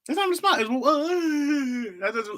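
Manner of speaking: high-pitched fake weeping wail; exaggerated sobbing whine; mock crying. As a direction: blubber the words in hammed-up despair. Mock crying over sad emoji.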